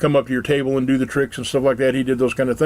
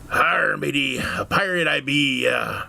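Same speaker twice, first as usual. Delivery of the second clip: slow gruff pirate growl, arr; 3 dB quieter, much slower than usual. Acted. Pirate answering the curious dad, slow and growly. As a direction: play the salty pirate, drawn out. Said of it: "guy kinda looks at him and goes".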